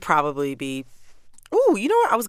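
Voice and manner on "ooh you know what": sudden realization. Real, not acted. Sudden idea mid-answer.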